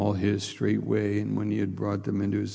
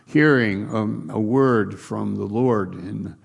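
louder, rising preacher cadence; building preaching; 6 dB louder than usual. Acted. Preacher stirring the church about preparation, building preaching. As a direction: build each line with more force.

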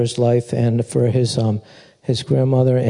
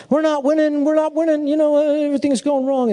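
much higher complaining voice; mock whining believer. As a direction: whine like someone sure they are losing. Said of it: "and it's like".

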